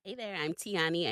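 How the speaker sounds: normal talking voice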